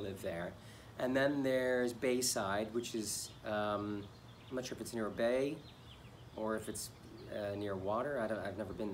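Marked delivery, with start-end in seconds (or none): none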